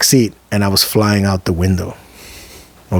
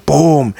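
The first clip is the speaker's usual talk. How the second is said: higher, punched boom; quick exclaimed crash noise; imitated impact; 6 semitones higher, 3 dB louder than usual. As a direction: voice a body slamming into the ceiling. Imitates hitting the ceiling.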